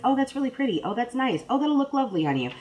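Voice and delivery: lightly voiced husband, mock-sweet and high; a hint of a polite husband cooing over purchases; husbandy. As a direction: play the dutiful husband admiring each thing, a bit high. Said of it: "very husbandy about it".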